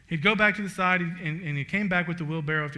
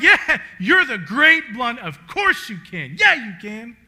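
crowd agreeing; 5 semitones higher, 12 dB louder, slower than usual. Retold or imitated.

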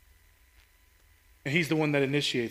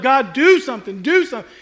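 louder, higher, pressing cry; urgent plea; 7 semitones higher, 7 dB louder than usual. Retold or imitated. People crying out to God, urgent loud plea. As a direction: beg God to act, urgently. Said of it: "they continue to just lift it up".

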